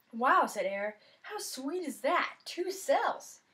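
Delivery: high, loud wow then slow delight; drawn out amazement at two phones; amazed. Acted. Boy marvelling to his friend about two phones, amazed.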